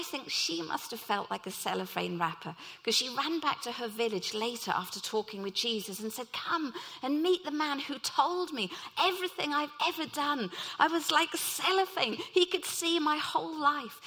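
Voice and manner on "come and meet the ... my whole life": excited villager. Retold or imitated. Woman runs back to village.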